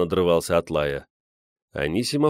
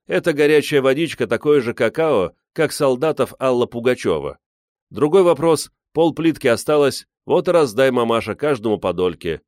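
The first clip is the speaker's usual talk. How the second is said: raised, lively wheedling; lightly voiced joker; 6 semitones higher, 4 dB louder than usual. A narrator's reading. Man talking mamasha into sharing chocolate, lively wheedling. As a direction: pitch up, pushing a cheeky proposal.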